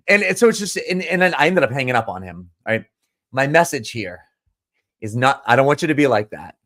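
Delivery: ordinary delivery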